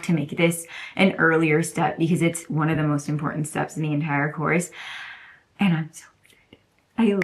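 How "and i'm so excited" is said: breathy secret whisper of excitement; excited whisper. Real, not acted. Telling viewers a secret, in an excited whisper.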